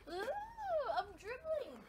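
ordinary talk, nothing marked

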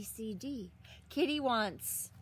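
usual speaking voice

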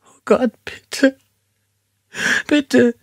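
very high, breathy sobbing whisper; woman sobbing at the gun pointed at her; sobbing plea. A narrator's reading. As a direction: sob it out, barely voiced. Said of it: "schluchzte sie".

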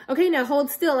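lightly voiced nurse giving orders; acted nurse instruction. Nurse telling the patient to hold still, lightly acted order.

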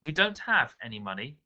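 plain reading voice

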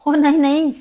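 loud denial. Acted. Wife denies husband's claim.

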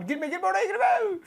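high, drawn-out call to stop; urgent warning. Real, not acted. Gamer warning teammate off an enemy, urgent high call. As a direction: yell at him not to go in.

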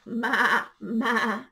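loud goat bleat. Acted. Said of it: "said the goat".

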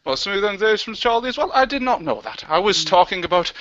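ordinary talk, nothing marked

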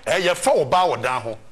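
mock retold quote.

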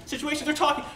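loud, high scripture reading; animated preaching. Real, not acted. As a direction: preach it out with big pitch swings.

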